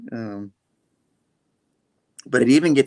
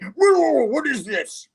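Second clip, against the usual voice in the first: shrieking mock villain; 14 semitones higher than usual. Doc Ock attacked by the goose, high shrieking alarm. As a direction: squeal it like a startled cartoon villain.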